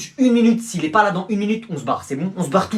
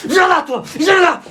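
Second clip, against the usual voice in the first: furious shout, higher and louder; 9 semitones higher, 5 dB louder than usual. Acted. Father yelling at the lawyer in court, furious shout. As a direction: lunge at him yelling.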